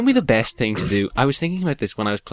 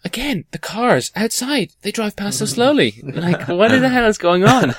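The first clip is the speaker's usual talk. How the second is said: incredulous; 12 semitones higher, 5 dB louder than usual. Real, not acted. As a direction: marvel at it in disbelief. Amazed at slow cars outside.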